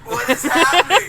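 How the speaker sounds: high, slow, loud disbelief; loud bewildered. Pretend. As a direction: shout in mock confusion.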